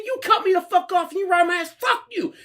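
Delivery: high-pitched ranting yell; angry accusation. Retold or imitated. Driver accusing the man who cut him off, ranting yell. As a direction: rant the charge at high pitch. Acted road-rage quarrel.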